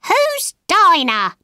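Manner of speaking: high, drawn-out parrot question. A narrator's reading. Parrot asking Alice about Dinah, high parrot voice. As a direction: ask slowly as a high-pitched parrot. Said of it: "asked the parrot".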